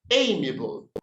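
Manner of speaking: amiable opening on a long 'ay'; corrected pronunciation. Shown as a demonstration. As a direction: model amiable as AY-mee-uh-bul. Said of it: "It's amiable".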